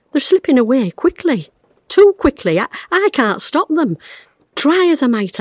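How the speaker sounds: high, strained, near tears; rising panic, voice pitched high; anguished despair. Acted. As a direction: fight the panic as memories slip away. Despair as memories slip.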